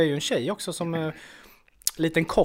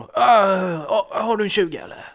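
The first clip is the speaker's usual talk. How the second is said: higher-pitched mock plea for money; imitated cadger; 4 semitones higher, 5 dB louder, slower than usual. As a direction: put on the pestering regular asking for cash. Imitates the man asking a girl.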